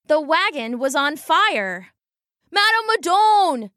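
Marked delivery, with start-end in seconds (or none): 2.5-3.7 yell. Acted. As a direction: shout her name in alarm. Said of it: "he yelled".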